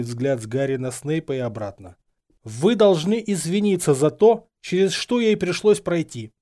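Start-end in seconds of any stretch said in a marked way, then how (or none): 2.4-6.3 raised, emphatic and firm; firm demand. A narrator's reading. Harry demanding an apology for Hermione, firmly. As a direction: insist on it, no room for argument. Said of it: "твёрдо сказал Гарри".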